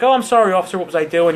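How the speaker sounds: a hint of a sweet flustered lady caught speeding; flustered lady driver. Woman pulled over after church apologizes to the officer, lightly voiced. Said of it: "she's like".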